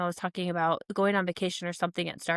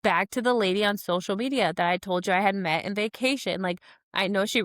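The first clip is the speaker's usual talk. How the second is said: normal talking voice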